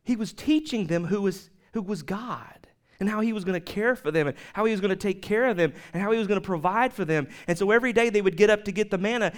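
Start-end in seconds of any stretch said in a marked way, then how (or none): none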